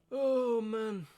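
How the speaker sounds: high, drawn-out sigh of dismay; long mournful lament; despairing. Real, not acted. Lamenting the lost audio.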